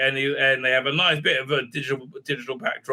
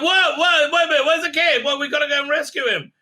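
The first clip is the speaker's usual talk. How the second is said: much higher, louder, hurried protest; alarmed outburst; 11 semitones higher, 4 dB louder than usual. Retold or imitated. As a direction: stop everything, we must go now. Pike alarmed about the kid.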